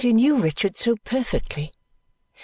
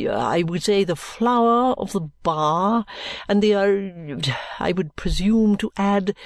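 pompous lawyer. Acted. Lawyer Kenge's long speech.

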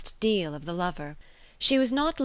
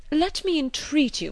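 repeated plea pitched much higher; higher, more insistent gallant plea; eager entreaty; 9 semitones higher than usual. A narrator's reading. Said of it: "cried Mister Elton".

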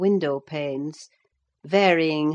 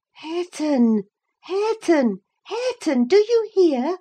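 high, mounting calls for attention; insistent calling; 12 semitones higher, 5 dB louder than usual. A narrator's reading. Catherine calls her cousin who won't answer, high and insistent. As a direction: call the name higher each time, then demand.

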